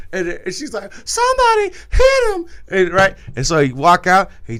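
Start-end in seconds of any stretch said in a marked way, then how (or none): 1.0-2.5 very high, loud cry for help; imitating the girlfriend crying out; mock shrill plea. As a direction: squeal it like a woman begging. Andrea voiced begging for help.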